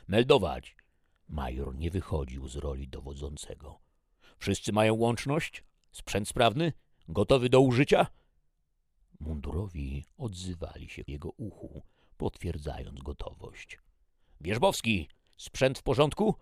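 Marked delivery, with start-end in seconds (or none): 0.1-0.8 loud, raised military order; barked command. Acted. Major orders squad to report. 4.4-8.1 loud, raised roll-call questions, building; commanding shout. Acted. Major checks squad's readiness. 14.4-16.3 high, loud call to a soldier; shouting. A narrator's reading. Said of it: "krzyknął w stronę siedzącego".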